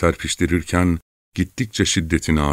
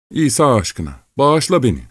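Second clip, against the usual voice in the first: high-pitched, desperate plea; implore, pitch leaping up; imploring; 8 semitones higher than usual. A narrator's reading. Frightened man begs for mercy.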